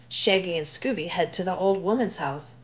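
plain reading voice

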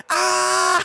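high, loud shriek of shock; startled scream. Retold or imitated. Catching the cat peeing on the TV, shrieking. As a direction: let out a sudden high yelp. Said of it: "I was just like".

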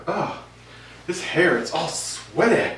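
ordinary talk

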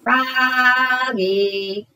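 high, drawn-out call. Acted. Teacher calling a daydreaming Froggy, long high call. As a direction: call across the classroom. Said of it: "cried his teacher".

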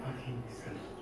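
very soft, awed question; hushed disbelief. Retold or imitated. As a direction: barely voice it, humbled. Humbled question to God.

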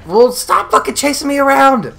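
high, loud, alarmed protest; startled yelling. Real, not acted. Gamer chased around by an enemy, startled yelling. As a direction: yell in panic at a pursuer.

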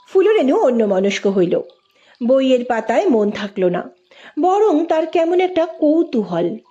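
ordinary delivery, nothing marked

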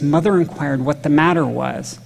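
ordinary talk

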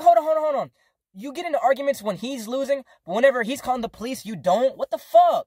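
high, loud, heated objection; outraged arguing. Retold or imitated. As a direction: cut in and argue, building to a yell. Retold argument with mom.

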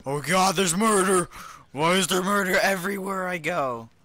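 high, loud panicked yelling; mock panic. Player stumbling on yet another murder, mock panic. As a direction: cry out in hammed-up horror. Comes upon murder in game.

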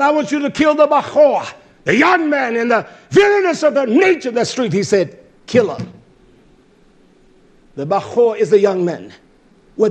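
loud sermon easing down from a high pitch; full-force preaching. Acted. Preacher listing young and old to be killed, full-force preaching. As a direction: hammer out the command, then gradually settle.

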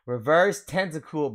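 ordinary talk

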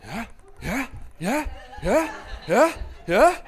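from mild to loud high taunting; mocking yeahs, building. Comedian mocking the heckler, building taunts.

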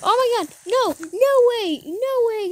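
can't believe what just happened; shocked disbelief. Real, not acted. Stunned by a sudden revolution.

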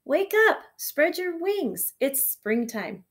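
drawn-out, high wake-up call. A narrator's reading. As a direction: rouse a sleeper with a storybook call. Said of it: "It called the, to the sleeping ladybug".

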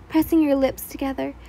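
usual speaking voice

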